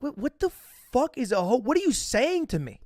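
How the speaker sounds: baffled. Retold or imitated. Baffled by the word host.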